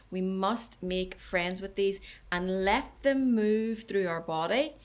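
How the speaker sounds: usual speaking voice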